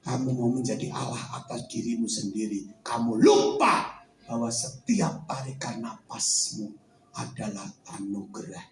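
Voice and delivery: the Lord speaking low and soft to Vincent; voice of God. Acted. God's voice told in a testimony.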